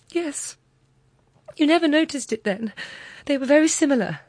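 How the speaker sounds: high and loud, beaming with pride; bursting with proud, innocent joy. Acted. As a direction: Mathilde boasting proudly to her friend, loud and high. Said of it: "she smiled with a joy that was at once proud".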